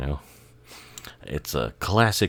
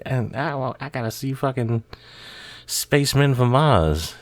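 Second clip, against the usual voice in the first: much higher, lighter mock Michael voice; [name] impression; 9 semitones higher than usual. Michael telling his brothers he must watch TV, in a mock high voice. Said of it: "and he'd be like".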